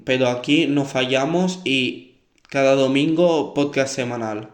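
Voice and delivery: ordinary delivery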